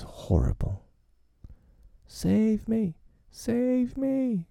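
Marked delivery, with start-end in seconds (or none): 2.1-4.5 high panicked plea; desperate cry. A narrator's reading. Nibs chased by wolves, crying for help. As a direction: scream for help, terrified. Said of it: "cried Nibs".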